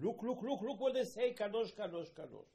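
high, loud, laughing look, look; delighted call. Acted. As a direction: call the angels over in glee. God delightedly calls the angels.

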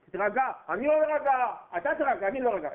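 angry refusal. Acted. Brother shouting, refusing to calm.

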